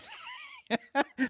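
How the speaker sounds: high burst of laughter. Real, not acted. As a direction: crack up at your own joke. Laughing at his own joke.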